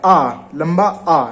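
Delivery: आ drawn out long and slow; long aa demo. Shown as a demonstration. Teacher showing students a long आ, drawn out. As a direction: stretch the vowel आ well out. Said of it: "हमारे पास यहाँ पे लंबा आ आ रहा है".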